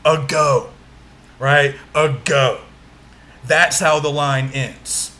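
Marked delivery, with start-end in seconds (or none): 0.0-0.7 ago with the weight on "go"; light "a", then a firm "go" in "ago"; second-syllable stress. Shown as a demonstration. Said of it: "we stress the second syllable". 1.9-2.6 ago with the weight on "go"; light "a", then a firm "go" in "ago"; second-syllable stress. Shown as a demonstration. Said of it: "we stress the second syllable".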